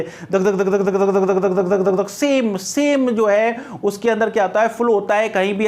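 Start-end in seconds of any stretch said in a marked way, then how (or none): 0.0-2.1 even rapid dhak dhak, same beat each time; machine gun imitation. Shown as a demonstration. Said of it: "machine gun जो है इस तरीके से चलती है". (मशीन गन जैसी एक समान धक धक आवाज़)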